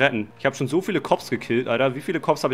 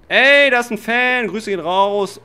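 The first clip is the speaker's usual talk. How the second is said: excited shout; 8 semitones higher, 9 dB louder, slower than usual. Real, not acted. Spots a fan in game.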